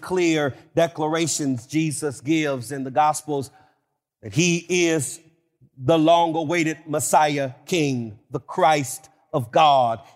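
usual speaking voice

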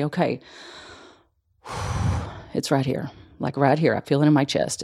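soft, sighing and calm, noticing the feeling; soothing self-talk. Shown as a demonstration. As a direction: breathe out and name the spot gently. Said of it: "stop and say".

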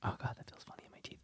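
whispering through a nose blow; muffled words while blowing nose. Real, not acted. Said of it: "I'm blowing my nose".

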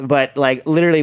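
ordinary talk

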